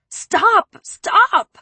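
very high, loud desperate cries; cried out. A narrator's reading. Narrator crying out to Preppy, panicked. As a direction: cry out to stop him hurting himself. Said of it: "I cried".

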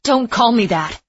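loud, high retort; snapping back. Acted. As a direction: cut her off sharply. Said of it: "Etta said".

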